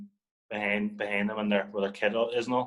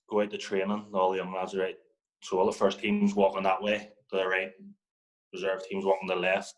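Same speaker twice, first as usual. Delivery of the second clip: usual speaking voice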